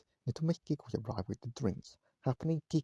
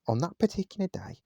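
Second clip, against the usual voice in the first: ordinary delivery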